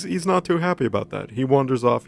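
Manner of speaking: ordinary talk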